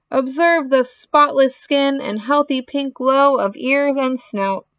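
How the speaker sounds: high-pitched, pompous proclamation; loudspeaker announcer. Acted. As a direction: proclaim it grandly to the crowd. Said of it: "continued the loudspeaker".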